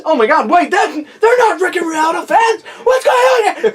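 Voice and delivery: high, loud panicked shrieking. Acted. Podcaster acting a fan facing gremlins, high panicked shrieks. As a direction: shriek in mock horror as the gremlins close in.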